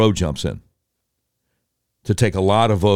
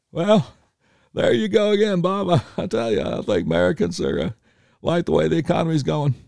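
Clinton doing Reagan; 6 semitones higher than usual. Retold or imitated. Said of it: "Clinton doing his best Reagan impersonation".

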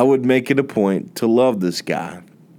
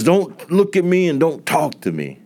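curt rebuff; 8 semitones higher than usual. Retold or imitated. Coworker's harsh rebuff quoted.

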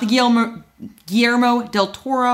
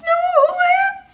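sing-song intro. Acted.

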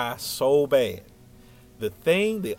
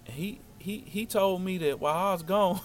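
imitating a nervous stammer, pitch raised; halting stammer; 7 semitones higher than usual. Boy confronting his crush about a rumor, halting stammer. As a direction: trip over the first words, restarting. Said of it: "this how I'm talking".

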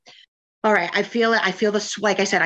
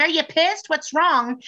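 a lightly voiced, higher, worried family member; family checking in; 8 semitones higher, slower than usual. Family knocking on her door, lightly mimicked in higher worried voice. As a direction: raise the pitch and ask with mock concern. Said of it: "they'll come knocking like".

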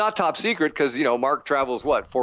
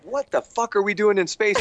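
higher, incredulous outburst; flipping out; 8 semitones higher than usual. Retold or imitated. Complaining to the director about the space set, incredulous. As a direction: throw the question up in disbelief. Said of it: "I just was flipping out".

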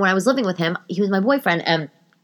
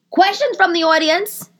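higher, slow mock announcer call to the crowd; mock host; 13 semitones higher, 4 dB louder, slower than usual. Pretending to host a show, asks the audience in a higher, slower voice. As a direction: play a host opening the floor.

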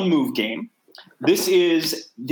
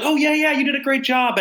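fake gushing praise; 11 semitones higher than usual. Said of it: "I'm not the kind of person who'll be like".

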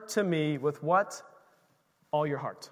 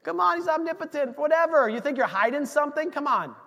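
lightly voiced God, amused; 7 semitones higher than usual. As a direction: play God gently teasing. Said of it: "He says".

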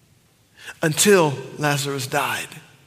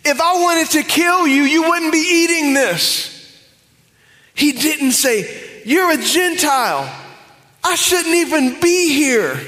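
high, loud, hammering preacher cry; loud preaching; 11 semitones higher, 5 dB louder than usual. Acted. Preacher rebuking wrong answers, loud preaching. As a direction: preach it at full force. Preacher builds to full force.

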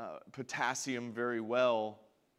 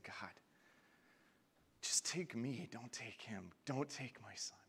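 breathy whisper, barely voiced; whispered prayer; 3 semitones lower, 11 dB quieter than usual. Pastor retelling his dad begging God at the hospital, whispered. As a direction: whisper it like a desperate prayer at a bedside.

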